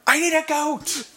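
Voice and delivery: high, thin wizard shout; wizard yell. Retold or imitated. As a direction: call out like a frantic wizard. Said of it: "hear Usador yell".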